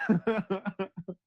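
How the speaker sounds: short amused laugh; chuckle. Real, not acted. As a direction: chuckle to yourself mid-thought. Laughs mid-story.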